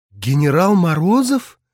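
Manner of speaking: put-on a young woman caught off guard; surprised. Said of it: "удивилась выглянувшая из гондолы Лиза".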